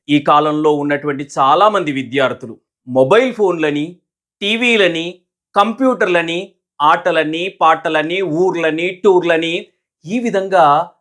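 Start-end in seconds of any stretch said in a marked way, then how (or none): none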